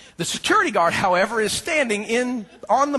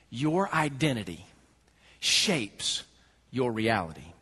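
softer, lower, slow and weighty; quiet emphatic repetition; 9 semitones lower, 6 dB quieter, slower than usual. Acted. Preacher repeats the key line quietly to the church. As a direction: lean in and drop the voice on each word. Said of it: "This is massively important".

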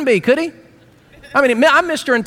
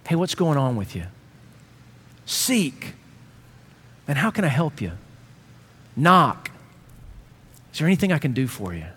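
gentle asking; 5 semitones lower, 5 dB quieter than usual. Acted. Gentle questions to someone hurting.

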